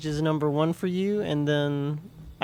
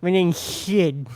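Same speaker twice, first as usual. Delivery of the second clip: slow lisping cartoon sloth voice; Sid voice; 3 semitones higher, 4 dB louder, slower than usual. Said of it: "he sure talks like this".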